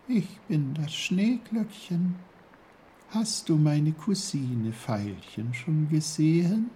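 high, dainty flower voice; small high character voice; little snowdrop, polite. A narrator's reading. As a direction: speak as a tiny snowdrop asking after a cousin. Snowdrop introduces itself.

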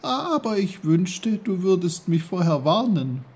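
put-on high, loud boy's protest; high-pitched, put-out complaint; Peter, protesting. A narrator's reading. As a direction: object indignantly in a young voice.

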